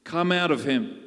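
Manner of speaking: loud, stern command; firm, forceful rebuke. Acted. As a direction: order it out with authority. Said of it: "said Jesus sternly".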